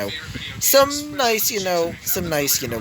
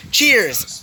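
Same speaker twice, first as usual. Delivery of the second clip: raised, louder group-toast call; rousing toast; 7 semitones higher, 5 dB louder than usual. A narrator's reading. Whole party answers the host's toast, rousing loud cheer. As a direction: answer the toast loudly and high.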